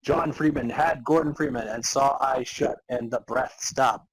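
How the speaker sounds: ordinary talk, nothing marked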